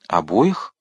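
mildly surprised question, rising at the end; understated astonishment, voice lifting on the word. A narrator's reading. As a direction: check it with a touch of surprise. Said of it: "удивился Рауль".

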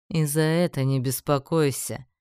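smug, dismissive assurance; smirking. A narrator's reading. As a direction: brush the worry off with a smirk. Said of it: "хмыкнул Рейми".

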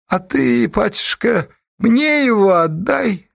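high, honeyed coaxing; affectionate, gentle. A narrator's reading. Forest stranger asks for the captive, tenderly. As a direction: coax sweetly in a high voice, drawing out the end. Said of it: "раздался вдруг ласковый голос".

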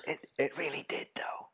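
whispered teasing aside; whispering. Real, not acted. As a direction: whisper it under your breath.

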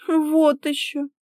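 high, slow, scornful refusal; displeased huff. A narrator's reading. Wife told to tidy up, high displeased huff. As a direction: huff at the very idea. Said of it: "недовольно фыркнула та".

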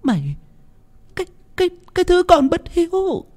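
feeble rasp. A narrator's reading. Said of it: "thều thào".

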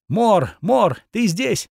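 high, clear searching call; ringing girl's voice. A narrator's reading. Girl calling for the hero through smoke, high ringing call. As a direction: call out for someone, pitched well up. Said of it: "раздался звонкий голос".

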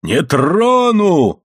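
high, drawn-out bellow; defiant yell. A narrator's reading. Enraged father lunging at the boy, yelling. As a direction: roar it, swooping up on the vowel. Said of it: "заорал Моррелл".

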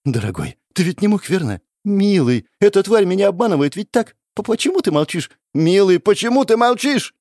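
high pleading on the verge of tears; tearful pleading. Acted. Goddess begs her silent beloved, tearful. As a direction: beg him to answer, crying. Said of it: "Она расплакалась".

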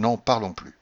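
usual speaking voice

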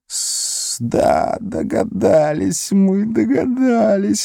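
high, hissed creature whisper; Gollum hissing. A narrator's reading. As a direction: hiss it like Gollum. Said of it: "шептал он".

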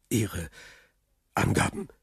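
soft, halting question rising at the end; unsure. A narrator's reading. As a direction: falter between the words, uncertain. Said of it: "fragte Villefort unsicher". (unsicher, zögernd und leise)

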